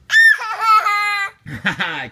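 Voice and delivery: high, loud burst of laughter. Real, not acted. Big laugh at toddler.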